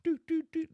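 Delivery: three high, ringing doots; imitated hammer taps. Showing the lieutenant a good spot, high imitated taps. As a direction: mimic a hammer ringing on solid metal. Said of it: "What's it sound like?".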